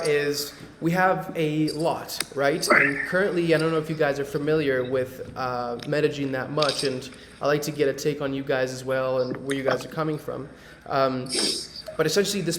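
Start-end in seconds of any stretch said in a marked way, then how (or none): none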